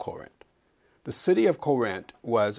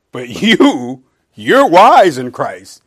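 much louder, slow mocking praise; biting sarcasm; 3 semitones higher, 16 dB louder, much slower than usual. Paul mocking the proud Corinthians, loud and slow. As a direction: drag out "wise" with scorn. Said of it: "He's using biting sarcasm".

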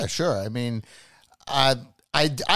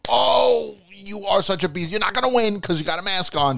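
high, animated mock protest at the screen; acted viewer reaction; 8 semitones higher than usual. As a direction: exclaim it like a riled-up fan. Acts out own reaction while watching.

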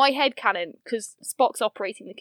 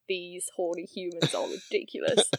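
disdainful low mutter; 5 semitones lower, 8 dB quieter than usual. Retold or imitated. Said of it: "expressions of, like".

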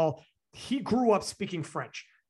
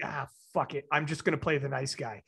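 lower, softer voice of giving up; resigniert nachgespielt; 6 semitones lower, 4 dB quieter than usual. Retold or imitated. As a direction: imitate a friend dropping the idea, low and subdued. Friend's inner giving up, retold.

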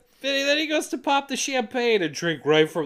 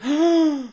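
big mock gasp, high and loud; exaggerated gasp; 12 semitones higher, 6 dB louder than usual. Man mocking a sulky sigh with a gasp. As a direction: gasp like he's fed up. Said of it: "Just go like".

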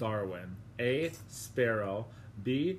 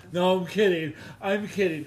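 laughing it off, higher; louder laughing retraction; 6 semitones higher, 7 dB louder than usual. Real, not acted.

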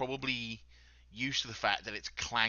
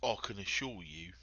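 soft, lowered voice with long pauses; menacing intimidator; 6 semitones lower, 11 dB quieter than usual. Acted. Crouched next to terrified Bertram at eye level, quiet menace. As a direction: threaten quietly, taking your time. Plays intimidator talking to captive.

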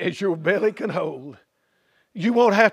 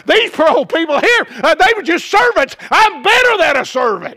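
loud, high, cocky bragging; boastful rich man; 5 semitones higher, 5 dB louder than usual. Acted. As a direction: swagger like he owns heaven. Boastful third man voiced.

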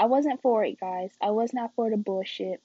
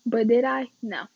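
normal talking voice

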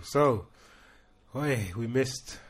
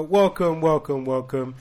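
loud welcome; 8 dB louder than usual. Acted.

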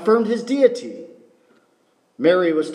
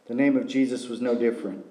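ordinary talk

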